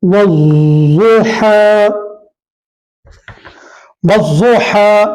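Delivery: वज़ुहा drawn out very slowly, व joined to a doubled ज़; slow recitation. Shown as a demonstration. Teacher slowly models वज़ुहा for the class. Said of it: "इसी तरीके से".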